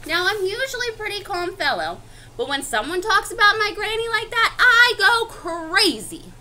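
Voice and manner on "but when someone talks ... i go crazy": higher, swelling storybook indignation; mock outrage. Wolf defending his granny's name, mock outrage. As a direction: build up to a stretched outburst.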